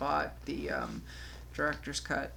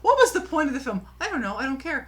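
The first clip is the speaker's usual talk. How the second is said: exasperated rant; 7 semitones higher, 5 dB louder, faster than usual. Real, not acted. Rant on the pointless film continues.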